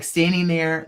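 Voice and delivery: ordinary delivery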